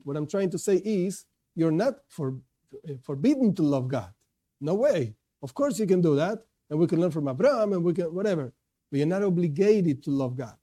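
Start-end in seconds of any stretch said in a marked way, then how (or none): none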